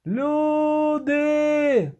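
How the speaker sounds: high, long-drawn holler; yelling. A narrator's reading. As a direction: yell it out to everyone, stretched. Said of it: "Чого він так репетує?". (протяжний високий крик)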